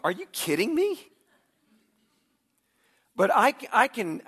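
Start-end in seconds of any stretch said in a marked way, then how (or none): 0.0-1.0 high, loud incredulous question; wife's indignant disbelief. Retold or imitated. Wife answers husband's list of her faults, indignant disbelief. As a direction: voice a wife throwing up a wall, pitch jumping. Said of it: "a wall would go up".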